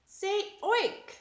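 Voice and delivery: high playful oink; pig oink. Pretend. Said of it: "The pigs".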